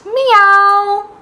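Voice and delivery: high, loud cat meow; playful high meow. Shown as a demonstration. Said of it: "and a cat".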